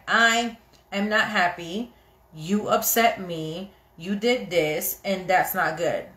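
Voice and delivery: slow, measured mock confrontation; intentional and literal. Wife telling husband he upset her, intentional and literal. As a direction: lay out each point deliberately. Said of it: "intentional and literal".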